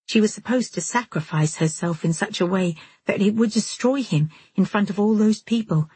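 plain reading voice

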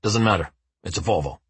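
low dry deadpan; matter-of-fact. A narrator's reading. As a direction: say it flatly, unbothered. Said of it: "very matter of fact".

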